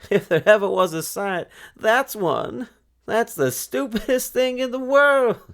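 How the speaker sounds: high, loud mocking yell; a girl jeering loudly at a strange farm. A narrator's reading. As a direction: scream it out in scorn. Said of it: "she yelled".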